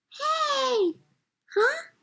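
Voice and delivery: high startled squeak; surprised mom. Acted. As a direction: yelp like you got caught. Mom caught cutting the line.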